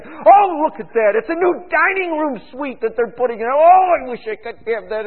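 hammed-up jealous sigh over what others buy; mock envious neighbor. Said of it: "You sit around and say".

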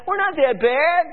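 a mocking jab at the leaders; leaders mocked. Preacher mimics leaders brushing off prophet, mocking smug. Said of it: "The leaders said".

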